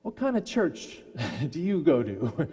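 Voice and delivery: amused, laugh breaking in; laughing question. Retold or imitated. Cook asking Tony about his church, laughing. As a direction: ask it through a laugh.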